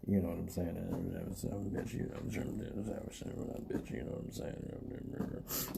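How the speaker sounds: very low, barely audible mumble; put-on low voice. Shown as a demonstration. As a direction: force the voice down until it nearly disappears. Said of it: "I have to put an effort".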